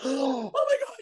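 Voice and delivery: high, breathless gasp of shock. Retold or imitated. Showing how to react to cancer news, high gasp. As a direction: gasp as if hearing terrible news. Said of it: "That's when you go".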